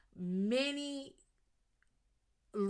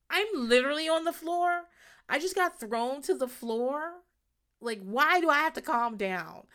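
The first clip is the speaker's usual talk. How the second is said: indignant protest; 8 semitones higher, 5 dB louder than usual. Retold or imitated. Lila protesting from floor.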